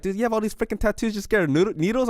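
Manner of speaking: mock a doctor's disbelief; lightly voiced doctor. Retelling the doctor's disbelief at his fear of needles, lightly voiced. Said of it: "look at me like".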